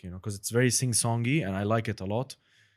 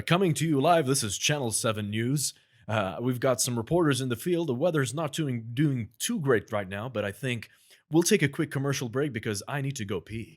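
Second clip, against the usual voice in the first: news anchor; 3 semitones higher, 4 dB louder than usual. Pretend. Asked for a news anchor, friend plays TV host. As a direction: announce it like a live TV anchor. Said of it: "let's hear news anchor".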